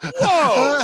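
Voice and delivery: loud, high startled whoa; retold shock. Cursed at by the loser, reenacting his shock. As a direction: act taken aback, high and loud.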